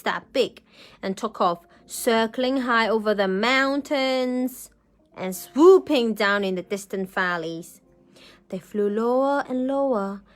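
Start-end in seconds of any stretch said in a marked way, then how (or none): none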